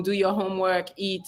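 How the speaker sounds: ordinary talk, nothing marked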